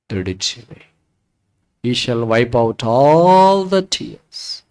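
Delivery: low, soft scripture recital; solemn preaching. Real, not acted. Preacher promises that all tears will be wiped out, solemn and low.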